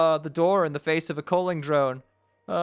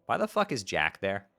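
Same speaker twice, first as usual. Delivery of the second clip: ordinary talk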